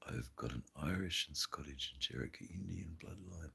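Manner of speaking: very soft, whispered read-out; barely audible whispered reading; under the breath. Real, not acted. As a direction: read it to yourself, almost voiceless. Reads chat comment under breath.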